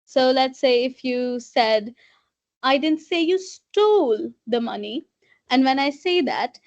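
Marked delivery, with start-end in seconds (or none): 2.6-5.1 "stole" stretched out and raised in pitch; stress on STOLE. Shown as a demonstration. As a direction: lean long on the word "stole", as if another verb were meant. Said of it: "Put some emphasis on stole".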